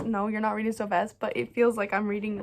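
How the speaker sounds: ordinary delivery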